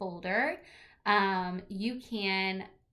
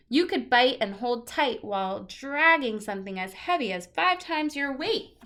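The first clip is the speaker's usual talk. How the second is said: lively picture-book read-aloud, louder than her chat; reading to children; 2 semitones higher, 5 dB louder than usual. Acted. Teacher reading a teeth book to her class, lively read-aloud. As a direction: read it out brightly to a class of kids.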